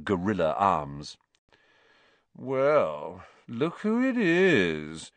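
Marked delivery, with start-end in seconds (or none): 2.3-5.1 slow, higher sneering drawl; lazy taunting schoolboy drawl. Acted. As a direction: stretch it out like a bored bully. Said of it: "in his usual lazy drawl".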